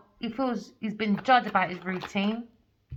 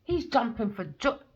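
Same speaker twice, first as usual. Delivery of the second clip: ordinary talk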